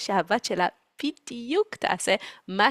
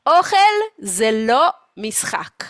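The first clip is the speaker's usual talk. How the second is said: slow, louder motherly pronouncement; mock scolding mother; 3 semitones higher, 6 dB louder, slower than usual. Mother lecturing the family about food, mock scolding. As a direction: lay down the rule slowly and loudly. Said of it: "פה היא אומרת".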